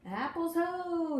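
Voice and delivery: slow, high, loud call; booming call of joy; cried out. A narrator's reading. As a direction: sing out the discovery like a pioneer. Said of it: "he cried".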